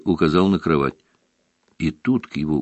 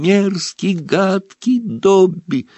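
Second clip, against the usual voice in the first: high, slow elf yelling at himself; self-scolding elf cry; 13 semitones higher, much slower than usual. Acted. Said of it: "кричал он при этом".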